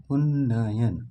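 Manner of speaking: उन्नयन with a long doubled न, said loud; sounding it out. Shown as a demonstration. Teacher sounds out the word to test spelling. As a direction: stretch the न in उन्नयन. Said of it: "उच्चारण करके देखेंगे".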